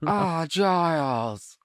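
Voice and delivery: put-on very slow, drawn-out posh English accent; hammed-up posh English lady. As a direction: stretch the English vowels grandly. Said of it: "how do you do that?".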